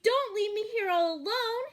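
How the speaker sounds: high, loud pleading cry; frightened sheep voice. A narrator's reading. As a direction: beg not to be left behind. Sheep chasing the tractor.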